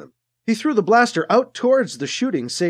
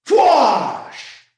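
louder falling whoosh of a beam; blaster whoosh; 7 semitones higher, 4 dB louder than usual. Acted. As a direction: make the beam sear past. Blast burns through the wall.

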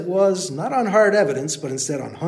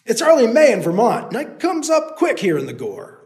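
loud, higher call; 6 semitones higher, 5 dB louder than usual. Acted. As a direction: call it up the trail. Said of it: "the man called".

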